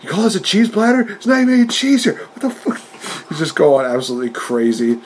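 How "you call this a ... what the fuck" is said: much higher, indignant mock rant; hammed-up outrage, pitched way up; mock crazed rant. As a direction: rant like he's lost it over missing cheese. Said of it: "going, like, batshit crazy".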